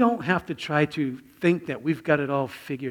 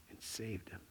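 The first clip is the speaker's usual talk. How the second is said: whisper; 6 semitones lower, 22 dB quieter than usual. Real, not acted. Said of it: "climax of Peter story".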